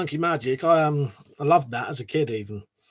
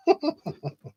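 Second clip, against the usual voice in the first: laugh; 12 semitones higher than usual. Real, not acted. Laughing at his own crying confession.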